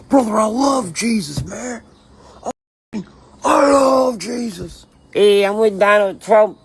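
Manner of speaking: high, hammed-up declarations; mock bad actor. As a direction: parody a fake, eager convert.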